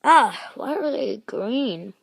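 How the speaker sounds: ordinary talk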